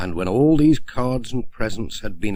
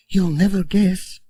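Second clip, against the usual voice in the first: teasing, higher postmistress voice; Mrs Goggins; 9 semitones higher than usual. Acted. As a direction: keep the secret playfully, pitched up. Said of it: "said Missus Goggins".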